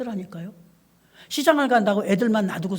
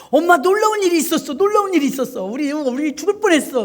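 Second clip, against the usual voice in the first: higher and louder, breathless news; a kid bursting to tell mom; excited child; 5 semitones higher, 5 dB louder than usual. Retold or imitated. 아이가 엄마에게 신나게 전함. (아이가 엄마에게 신이 나서 전하는 목소리)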